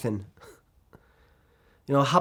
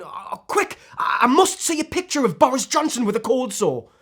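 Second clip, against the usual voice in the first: hammed-up frantic excitement, pitched higher; mock urgency; 6 semitones higher, 3 dB louder than usual. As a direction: sound desperate for it, mockingly. Mocking an imagined frantic celebrity-gawker.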